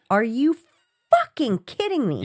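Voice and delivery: exasperated, punched disbelief; mock outrage, hitting the swear word; retold freak-out. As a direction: act out losing your patience. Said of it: "I was starting to freak out".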